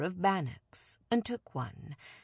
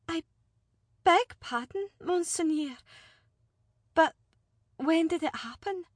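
higher, stunned noblewoman; shaken lady; 11 semitones higher than usual. Acted. Alina asking the envoy when her father died, stunned. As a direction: ask through the shock. Daughter hears of father's death.

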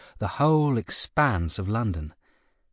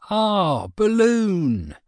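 high, drawn-out cry of awe; gasp of wonder; 8 semitones higher, much slower than usual. A narrator's reading. Narrator voices a crowd watching a balloon rise, awed wonder. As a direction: marvel aloud at the sight. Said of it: "the crowd gasped in wonder".

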